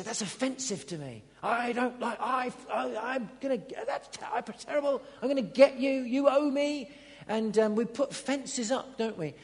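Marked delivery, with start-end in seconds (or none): 0.0-6.9 a hint of a resentful grudge-holder, rising to a mock threat; grudging offended person. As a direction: play the offended one building fences. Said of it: "We say".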